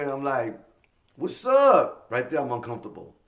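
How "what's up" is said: high, loud hype opener; big shouted hello; loud greeting. Retold or imitated. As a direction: fire up the gym crowd. Opening at high school.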